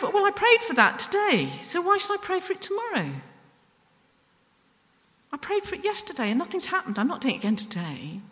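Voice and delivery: high-pitched mock protest of someone giving up; imitated grumbling, pitched well up; mock complaint. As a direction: protest it high, as a reluctant pray-er. Voices someone giving up on prayer.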